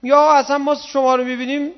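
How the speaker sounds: full-force preaching. Real, not acted. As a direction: preach it hard and drawn out to the crowd. Sermon climax at full force.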